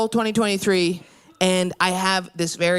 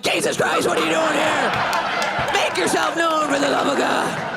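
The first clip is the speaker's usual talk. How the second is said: mock startled yell; 7 semitones higher than usual. Startled by roommate.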